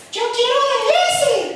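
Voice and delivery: put-on high, whiny kid voice; whining child. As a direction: beg like a little child wanting that one.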